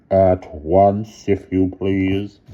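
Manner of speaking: slow loud controlled headmaster; suppressed anger. A narrator's reading. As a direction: press it with cold anger.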